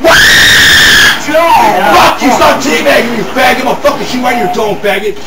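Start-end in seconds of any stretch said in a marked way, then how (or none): none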